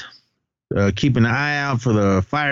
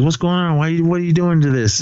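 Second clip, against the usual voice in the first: higher-pitched, questioning protest; retold bewildered protest; 8 semitones higher than usual. Onlooker challenging the principal's arrest, bewildered.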